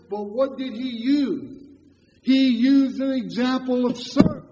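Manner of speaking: slow, loud, ringing pulpit delivery; preaching at full force. Real, not acted. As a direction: thunder it out to the congregation. Preacher building to his point.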